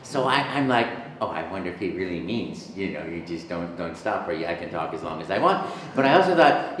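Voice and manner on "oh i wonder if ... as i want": low, soft musing to himself; bratty. Retold or imitated. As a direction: think it over quietly, like a sly brat. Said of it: "I'm a little bit of a brat".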